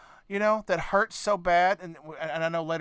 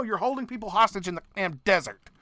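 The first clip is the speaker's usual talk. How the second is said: ordinary talk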